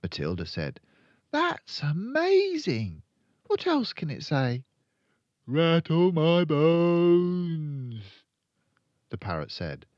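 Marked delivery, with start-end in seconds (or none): 1.3-4.6 put-on high little-girl voice, first words stretched out; high, amazed girl character; amazed Matilda. A narrator's reading. Matilda marvels at the parrot. 5.5-8.0 loud, high, long-drawn spooky wail; high haunting parrot voice, very slow. A narrator's reading. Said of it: "a wonderful imitation of a spooky voice".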